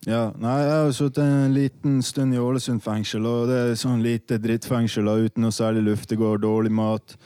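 ordinary talk

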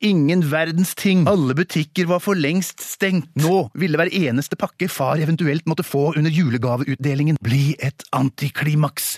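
plain reading voice

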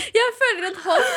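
high burst of laughter; giggling through the surprise; laughing. Real, not acted. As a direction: crack up mid-story. Laughing at stranger's hand.

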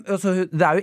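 usual speaking voice

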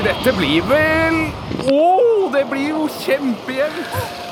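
high, slow, breathless tension; tense excitement. Real, not acted. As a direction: hold your breath on the result. Nail-biting moment.

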